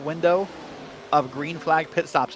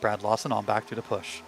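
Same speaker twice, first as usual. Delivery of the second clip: hushed, lower golf commentary; golf announcer voice; 5 semitones lower, 8 dB quieter than usual. Pretend. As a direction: murmur it like a golf broadcast. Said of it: "break out your golf announcer voice".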